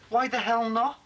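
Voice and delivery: high, indignant challenge; angry demand. Acted. Man challenges Michelle's refusal, angry. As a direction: demand a reason, pitch jumping up.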